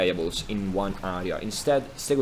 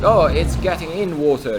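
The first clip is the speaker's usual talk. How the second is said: loud high sudden exclamation; excited outburst; 6 semitones higher, 8 dB louder than usual. Real, not acted. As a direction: burst out as the enemy moves. Enemy suddenly moves.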